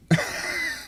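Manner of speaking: short high-pitched laugh; brief burst of quiet, high laughter. Real, not acted. Laughs mid-story.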